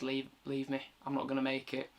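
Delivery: softly retold plea to be left behind; resigned plea. Wife trapped in the plant tells her husband to leave, quiet resigned plea. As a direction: quote her quietly, without drama. Voicing the dying wife's plea.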